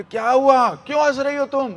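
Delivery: high, loud exasperated questions; झुंझलाई Gopi. Retold or imitated. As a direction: demand an answer in acted indignation. गोपी हंसी पर भड़की. (ऊँची आवाज़ में झुंझलाया सवाल)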